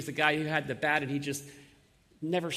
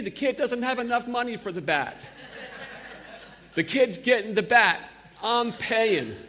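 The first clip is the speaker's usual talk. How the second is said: put-on loud, higher Bronx accent with laughter breaking through; 5 semitones higher, 4 dB louder than usual. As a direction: ham up two bickering New Yorkers in thick Bronx accents. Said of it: "with my best Bronx accent".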